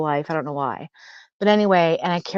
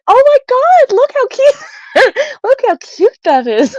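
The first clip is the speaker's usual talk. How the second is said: much higher, louder squeal of delight; gushing over cute; 8 dB louder than usual. Retold or imitated. Spotting a mouse in nurse's uniform on the wall, squealing with delight. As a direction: coo at it like a tiny puppy.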